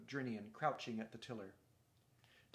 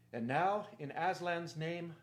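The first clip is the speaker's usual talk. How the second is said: loud, raised command; rallying cry; 5 semitones higher, 8 dB louder, slower than usual. A narrator's reading. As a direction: call the crew onward in a big voice. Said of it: "cried Caspian".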